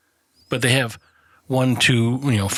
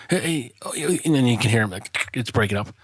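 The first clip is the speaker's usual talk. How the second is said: usual speaking voice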